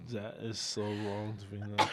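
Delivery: ordinary delivery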